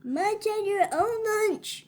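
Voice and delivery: annoyed friend. Acted. Friend scolding greedy shark.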